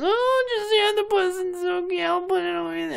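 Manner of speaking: high slow falsetto coo; mock the mother's pampering; mock doting mother.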